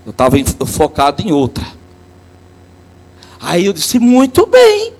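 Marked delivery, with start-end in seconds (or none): 4.0-4.9 sudden leap up into bright cheering praise; high excited praise. Retold or imitated. Priest retelling how he praised a child, high excited cheer.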